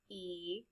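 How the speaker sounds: E as a soft, long "ee"; long vowel sound. Shown as a demonstration. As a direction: say E softly as a held "ee". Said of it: "E makes a long sound".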